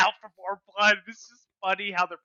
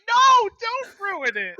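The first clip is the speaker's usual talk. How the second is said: much higher, louder, slow mock whine; pleading Gretel; 13 semitones higher, 8 dB louder, much slower than usual. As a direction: beg him not to spoil it, hammed up. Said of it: "And he's like".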